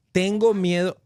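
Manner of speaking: out loud. Shown as a demonstration. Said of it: "hay que decirlo en voz alta".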